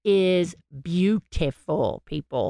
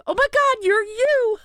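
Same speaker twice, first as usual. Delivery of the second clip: much higher and louder starstruck squeal; fangirl squeal; 5 dB louder than usual. Retold or imitated. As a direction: gush like a fan meeting her idol. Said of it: "I fangirled so bad over her".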